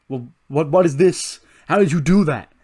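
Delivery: high, loud incredulous questions; baffled outburst. Acted. Andre Chase to the mysterious intruder, baffled high outburst. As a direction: build into loud disbelief. Voicing baffled wrestler reacting to figure.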